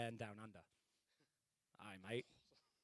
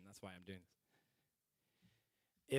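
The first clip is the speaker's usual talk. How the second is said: ordinary delivery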